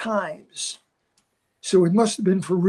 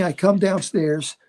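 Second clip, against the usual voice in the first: ordinary talk, nothing marked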